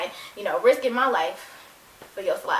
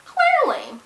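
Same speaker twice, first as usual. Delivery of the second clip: emphatic clearly; 14 semitones higher, 4 dB louder than usual. Real, not acted. Emphatic aside.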